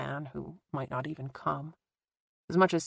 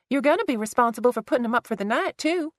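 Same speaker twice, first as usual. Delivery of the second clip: quick, higher bossy sister; lightly voiced Tessa; 7 semitones higher, 3 dB louder than usual. A narrator's reading. Tessa ordering her sister to host, quick and higher. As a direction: toss the chore at her sister.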